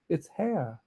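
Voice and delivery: usual speaking voice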